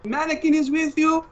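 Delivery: a doting father checking on his son; Papa on the phone. Acted. Papa calls his son mid-mission, high accented voice.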